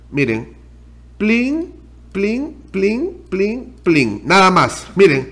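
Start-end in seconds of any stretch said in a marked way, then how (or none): 1.2-4.2 mouth chime sound. Pretend. Mimics payment app sound.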